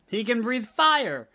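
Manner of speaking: slow, high, loud and emphatic; hyped up. Real, not acted. Co-host piles on the dragon joke, slow, high and loud. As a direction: build the hype word by word.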